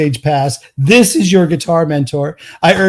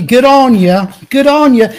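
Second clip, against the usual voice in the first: higher, hammed-up Aussie good on ya; Australian accent; 7 semitones higher, 3 dB louder than usual. Host replaying his Australian accent for a listener. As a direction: toss off the Aussie line with a grin. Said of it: "amazing accent that you were just doing".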